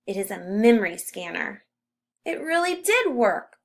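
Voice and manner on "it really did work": higher, amazed exclamation; boy amazed by his own invention; exclaiming. A narrator's reading. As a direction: marvel that it worked. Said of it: "Louis exclaims".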